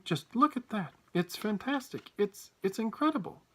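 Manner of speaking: high-pitched excited wonder; breaking the silence with astonishment; amazed shouting. A narrator's reading. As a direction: marvel at the sight. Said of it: "he was shouting".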